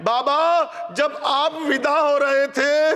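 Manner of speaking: keen the tragedy so the hall weeps; full-force lament preaching. Acted. Majlis lament, voices Sakina and others.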